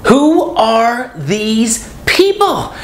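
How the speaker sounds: high, very slow, stretched-out question; incredulous. Real, not acted. Asking viewers again about ghosters, high and very slow. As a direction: let the disbelief drag every word.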